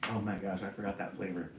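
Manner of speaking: usual speaking voice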